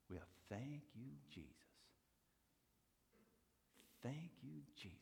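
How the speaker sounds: soft, thankful Cajun voice; soft Cajun thanks; grateful Cajun accent. Retold or imitated. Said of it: "with the most beautiful Cajun accent".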